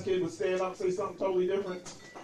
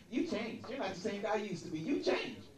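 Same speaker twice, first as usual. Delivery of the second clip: leaned-in softer reproach; softer, accusing ex; 6 dB quieter than usual. Retold or imitated. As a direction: say it softly, close and wounded. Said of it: "She leaned into me".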